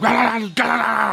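high-pitched mock dog barking; rapid, high vocal dog imitation; imitated frantic barking. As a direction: bark like a dog freaking out. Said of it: "loses his shit".

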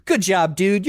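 ordinary talk

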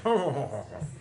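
lightly voiced old British gent chuckling; a hint of a tweedy Englishman going ho ho; mild mock British gentleman laugh; British walrus-mustache gent. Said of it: "thick walrus mustaches and say".

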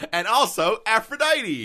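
high, loud joking squeal; excited squeal. Real, not acted.

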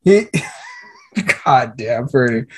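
slow words broken by a laugh; chuckling disbelief. Real, not acted. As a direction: laugh through the words at the joke. Laughing at friend's impression.

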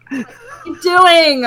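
high, slow, laughing quote of an annoyed player; opponent on the mic. Retold or imitated. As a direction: mimic an online opponent. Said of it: "they just talk at you".

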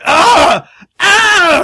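high, loud yelps of pain; imitated pain screams. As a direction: yelp twice, high and loud, as the victim. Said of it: "and he's like".

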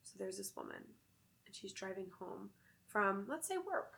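soft, hushed spooky storytelling. Acted. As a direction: tell it like a campfire tale. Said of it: "I'm gonna get into my storytelling voice".

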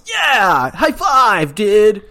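high, loud surfer-dude turtle; Ninja Turtles voice. Acted. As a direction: shout it like a pumped-up Ninja Turtle. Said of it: "You just talk like Ninja Turtles the whole time".

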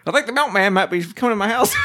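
high, quick vintage husband; old-timey man. Acted. Co-host jokes about the milkman, old-timey voice. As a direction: patter like an old movie husband.

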